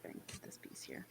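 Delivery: breathy whisper to the pet; whispered aside. Real, not acted. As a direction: murmur it under your breath.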